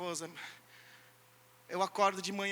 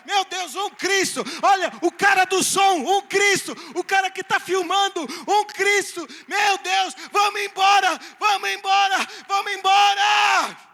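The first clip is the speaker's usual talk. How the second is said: high frantic yelling, louder and louder; panicked shout; 9 semitones higher, 13 dB louder than usual. Acted. Demon terrified at seeing many Christs, shouting. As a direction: scream to run away in terror.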